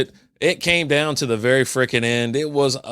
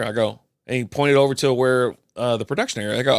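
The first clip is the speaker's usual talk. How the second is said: ordinary talk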